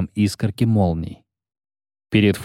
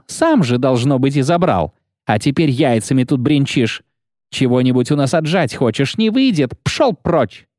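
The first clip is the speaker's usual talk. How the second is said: burly commandant; 4 semitones higher than usual. Acted. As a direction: bark at him like a brute. Commandant shouts at Geralt.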